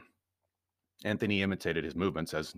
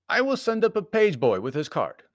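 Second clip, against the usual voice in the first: high, louder clerk's voice; desk clerk; 12 semitones higher, 5 dB louder than usual. A narrator's reading. Clerk announcing a visitor by telephone, high role voice. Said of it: "and then the clerk said".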